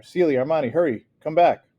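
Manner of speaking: high, loud frantic calls; mother screaming for her children. Acted. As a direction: scream for the children to come back. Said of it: "Mama screamed".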